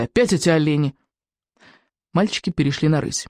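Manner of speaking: ordinary delivery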